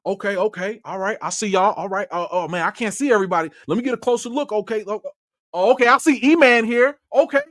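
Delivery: put-on Ryan Coogler Oakland accent. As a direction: loosely imitate a relaxed Oakland accent, Coogler spotting a friend. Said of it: "I'm gonna try and do my Ryan Coogler Oakland accent".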